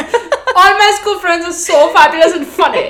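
high, loud, slow sing-song laughing imitation; teasing the friend who left her out, gushing parody; mocking friend's gushing. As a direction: mimic her gushing praise. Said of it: "Your fucking huge list".

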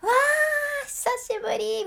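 high excited cry; delighted reunion. Retold or imitated. Meeting a dear friend again, excited and high. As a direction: greet a long-lost friend, bursting up high. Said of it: "自然に".

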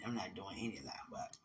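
hushed, whispered no; whispered refusal. Real, not acted. Telling a friend what she won't eat, in a whisper. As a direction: whisper it firmly.

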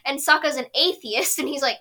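usual speaking voice

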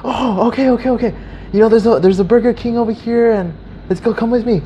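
high, eager imitation of the beggar; retold eager babble; excited. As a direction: act all excited, pitch up, urging along. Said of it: "he gets all excited".

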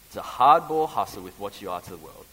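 emphatic repeat. Real, not acted. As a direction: punch "hardball". Preacher repeats key phrase.

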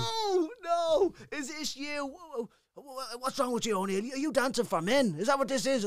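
high, loud incredulous officer; outraged sergeant major. Retold or imitated. Sergeant major confronting the stripper soldier, high and incredulous. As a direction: demand it like a scandalised sergeant. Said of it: "I was like".

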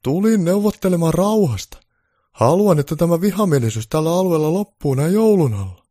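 Santa's high, loud peace offer; Santa Claus voice. Acted. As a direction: play Santa come to make peace.

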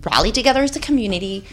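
fast, rushed rallying talk; fast rant. Real, not acted. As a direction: rush it out.

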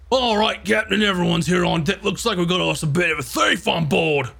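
gruff ship's officer reporting in; Skullbreaker. Acted. Officer reports a thief to the captain, calling out. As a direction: announce it to the captain with put-on swagger. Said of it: "Skullbreaker's like".

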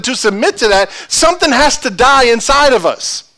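high, driving sermon appeal; impassioned preaching. Real, not acted. Preacher asking congregation to submit to God's truth, impassioned preaching. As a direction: press the congregation with high intensity.

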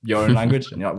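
normal talking voice

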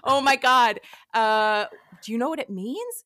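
startled, laughing it off; flustered surprise. Retold or imitated. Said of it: "I was like".

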